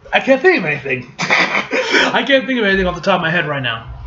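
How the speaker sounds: ordinary talk, nothing marked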